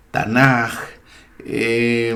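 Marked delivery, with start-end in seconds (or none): 0.1-0.7 taNAJ, Tanaj stressed firmly on the last syllable; final-syllable stress. Shown as a demonstration. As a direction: lean on the "naj" of Tanaj. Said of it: "el acento recae siempre en la última sílaba".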